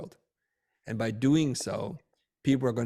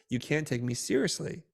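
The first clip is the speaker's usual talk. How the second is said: ordinary talk, nothing marked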